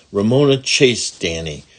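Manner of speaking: plain reading voice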